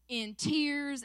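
normal talking voice

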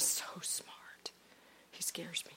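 slow, soft whisper; confiding whisper. Real, not acted. Preacher confiding to the congregation about her husband, whispered. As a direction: lean in and whisper it.

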